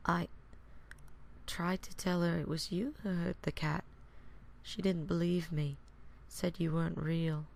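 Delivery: put-on soft, high, dejected child; quiet, downcast little voice; Isaac, teary-eyed. A narrator's reading. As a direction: say it small and hurt, close to tears. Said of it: "a teary-eyed Isaac".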